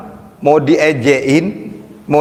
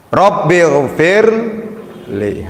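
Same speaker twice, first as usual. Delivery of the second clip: slow prayerful recitation; 3 semitones higher, much slower than usual. Shown as a demonstration. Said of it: "saya mau ngajari mencapai ketasawufan".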